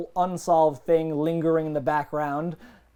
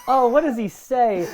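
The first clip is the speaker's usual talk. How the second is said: ordinary talk, nothing marked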